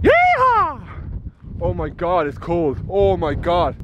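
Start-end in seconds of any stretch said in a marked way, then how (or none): 0.0-0.9 high loud cowboy yell; excited yeehaw. Real, not acted. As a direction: holler with joy. Celebrating on summit.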